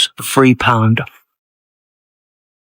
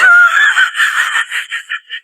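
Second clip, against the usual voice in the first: shrieking laugh. Real, not acted. Shocked at supermarket prices.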